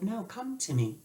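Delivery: hushed, gentle call; a soft inner voice calling; soft soothing voice. Retold or imitated. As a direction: coax them in softly. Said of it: "this very soft voice be like".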